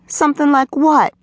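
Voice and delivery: put-on high-pitched sulky little girl. A narrator's reading. Girl sulking, questioning boy's apology.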